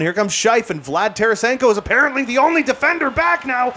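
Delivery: high, rising urgent call; excited commentary climbing to a shout; tense play-by-play. Real, not acted. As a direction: build alarm as the rush comes. Opponent breaks toward the net.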